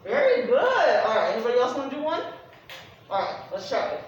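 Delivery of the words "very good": high praise. Real, not acted. Praising a student demo.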